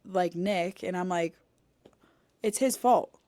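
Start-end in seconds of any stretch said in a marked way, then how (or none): 2.4-3.2 higher, louder mock accusation; half-joking loud blame of the husband. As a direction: pin it on him in a raised voice. Blaming Nick, retold.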